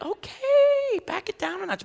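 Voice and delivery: very high, squeaky mock comeback; squeaky retort. Pastor voices his own high, squeaky reply to Scott. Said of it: "And I'm like".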